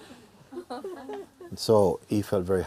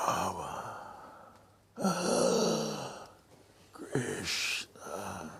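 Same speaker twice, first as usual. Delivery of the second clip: dying gasps; 7 dB quieter, much slower than usual. Shown as a demonstration. Teacher demonstrating a dying father gasping out his sons' names. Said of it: "Like this, no?".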